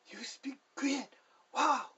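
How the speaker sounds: high, loud burst of amazement; delighted astonished exclamation; amazed father-in-law. Retold or imitated. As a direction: gasp it out like he can't believe it.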